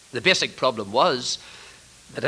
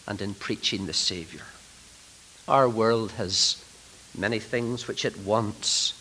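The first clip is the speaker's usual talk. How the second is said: hushed earnest preaching; 4 semitones lower, 4 dB quieter than usual. Real, not acted. Preacher telling fellow ministers what the world needs, hushed. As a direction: lower and softer, with weighty pauses. Preacher drops to solemn appeal.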